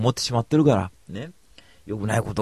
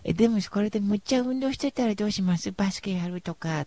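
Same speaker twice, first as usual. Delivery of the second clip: put-on higher playful young woman's voice; girl's teasing voice; 10 semitones higher than usual. Tifa teasing him about playing basketball, in a high girlish voice. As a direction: tease him in a light girlish voice. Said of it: "ティファちゃんがさ".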